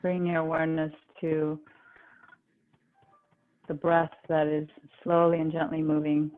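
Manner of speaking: calm, even, unhurried relaxation guide; guided meditation. Real, not acted. Yoga teacher guiding the class into relaxation, calm guided meditation.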